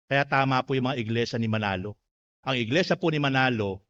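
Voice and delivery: ordinary delivery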